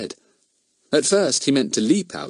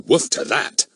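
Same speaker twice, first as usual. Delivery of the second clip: doggy talk, slightly raised; rude barking dog; 4 semitones higher than usual. Acted. Dog answers back to the threat, rudely barking. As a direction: bark the words back cheekily. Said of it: "barked Buster rudely".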